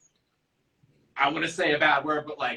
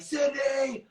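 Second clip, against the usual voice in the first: calling out; 11 semitones higher than usual. Retold or imitated. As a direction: yell the name to get her attention. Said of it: "I, I screamed".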